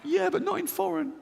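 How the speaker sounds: high, giggling scared voice; fearful inner voice. Retold or imitated. Fearful inner voice defending its prejudice, high and giggly. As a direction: pipe it up, half laughing. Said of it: "This one goes".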